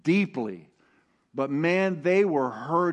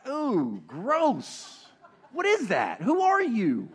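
comic recoiling "ew, gross" voice; mock disgust; 6 semitones higher, slower than usual. God recoils from a stagey prayer with mock disgust. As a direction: pull back from something weird in play disgust. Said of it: "He's like".

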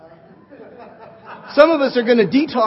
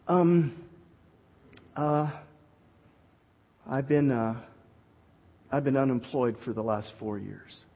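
much softer, halting and hesitant; imitating a man admitting he's jobless, sheepish and quiet; embarrassed unemployed man; 8 semitones lower, 9 dB quieter than usual. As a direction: mumble it like you're ashamed to admit it.

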